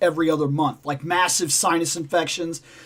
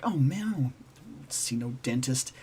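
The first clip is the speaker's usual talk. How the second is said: slow, low, much softer grumble; grumbling refusal; 5 semitones lower, 10 dB quieter than usual. Retold or imitated. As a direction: mutter it like a stubborn patient. Grumbling refusal to see dentist.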